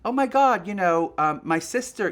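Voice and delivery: high, loud starstruck burst; mock gushing fan. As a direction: imitate a breathlessly keen admirer. Second gushing fan acted out.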